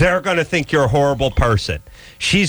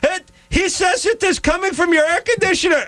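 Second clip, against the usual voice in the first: much higher put-on voice of a pestering neighbor lady; neighbor woman calling. Neighbor lady summoning Adam to look, in a much higher put-on voice. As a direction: squeal for Adam like a nosy neighbor.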